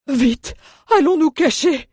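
high, loud panicked dwarf; cried out. A narrator's reading. The dwarf crying out to hide from the soldiers, high and panicked. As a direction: yelp it in a panic. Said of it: "s'écria le nain".

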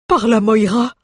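high, forceful character oath; dwarf swearing. A narrator's reading. Dwarf swears at noise behind the prison door, alarmed oath. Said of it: "jura Mjoln".

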